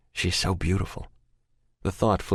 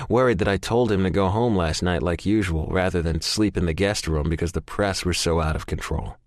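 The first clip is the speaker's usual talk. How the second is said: ordinary delivery, nothing marked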